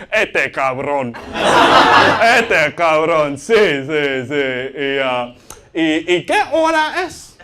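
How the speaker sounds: slow, laughing Spanish muttering; showy Spanish phrases. Retold or imitated. Trying to get nearby Latinos to notice him, slow and laughing. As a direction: drop Spanish words slowly to be noticed. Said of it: "I love to go".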